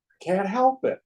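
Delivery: helpless protest. Acted. Seeker admits he cannot let go, helpless protest.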